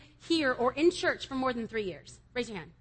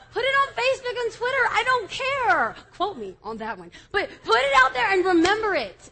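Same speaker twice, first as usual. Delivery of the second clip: fiery exhortation; 8 semitones higher, 11 dB louder than usual. Real, not acted. Preacher warning the youth to run from temptation, shouting high and loud. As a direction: preach it at full force.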